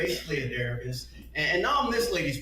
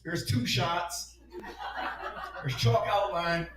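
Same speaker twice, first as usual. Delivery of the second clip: mock introduction; 6 semitones higher, slower than usual. Listing Detroit friends' names.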